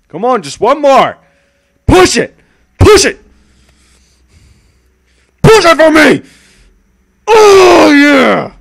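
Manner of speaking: loud high urging shouts; mock coach. Streamer cheering himself on like a gym coach, shouting. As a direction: push them hard like a gym coach.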